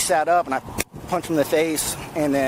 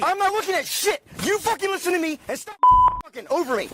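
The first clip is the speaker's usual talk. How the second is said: angry yell; 9 semitones higher than usual. Real, not acted. Officer yelling at detained man.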